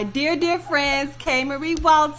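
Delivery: rising, drawn-out welcome of a guest; excited introduction. Real, not acted. Host brings on a dear friend, excited introduction.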